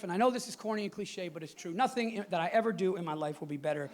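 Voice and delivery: softly sincere dad. Real, not acted. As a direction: speak it gently from the heart. Sincere about his kids.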